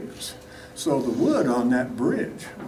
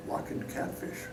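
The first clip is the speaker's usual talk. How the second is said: hushed, breathless reveal; whisper; 13 semitones lower, 19 dB quieter than usual. Retold or imitated. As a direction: drop to a secret whisper. Dad's secret-like line.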